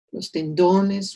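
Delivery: ordinary talk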